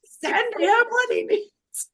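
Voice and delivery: high pleading prayer. Retold or imitated. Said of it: "and I'm just like".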